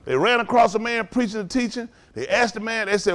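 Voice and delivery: ordinary talk, nothing marked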